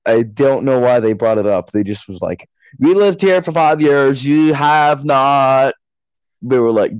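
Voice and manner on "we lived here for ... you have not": slow, higher-pitched, stretched complaint; mock self-important neighbour. Neighbours claiming the road, mock haughty. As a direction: imitate pulling rank, drawing it out. Neighbours asserting residency again.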